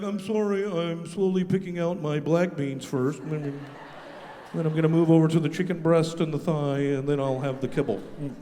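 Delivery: soft fussy snob; fussy picky dog voice. Retold or imitated. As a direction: sniff at it like a picky eater. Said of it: "he's like".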